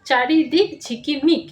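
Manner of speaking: চারিদিক ঝিকিমিক read smoothly as whole words; চারিদিক ঝিকিমিক blended into whole words. Shown as a demonstration. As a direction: read চারিদিক ঝিকিমিক joined up, a little slow. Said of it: "এইভাবেই তোমরা পড়বে".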